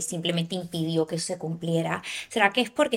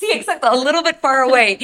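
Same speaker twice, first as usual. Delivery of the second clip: excited; 6 semitones higher, 12 dB louder than usual. Real, not acted. Echoing guest's joke, laughing.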